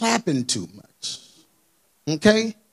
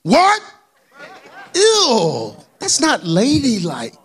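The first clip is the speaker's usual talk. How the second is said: mock prissy shock; slower than usual. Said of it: "when somebody cross you".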